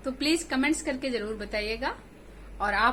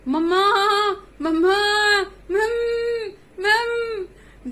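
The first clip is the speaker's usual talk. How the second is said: loud, higher cries of mamma, near crying; calling out; 8 semitones higher, 7 dB louder, slower than usual. Acted. Kids inside the bear's belly calling their mother, loud cries. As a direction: shout for mamma from far inside. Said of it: "वहीं से चुन्नू मुन्नू ने आवाज दी".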